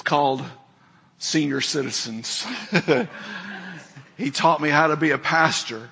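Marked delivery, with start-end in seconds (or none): 2.5-3.7 brief, quiet laugh to oneself; a small amused chuckle; short chuckle. Real, not acted. Chuckles after naming senior citizens.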